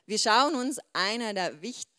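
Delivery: ordinary talk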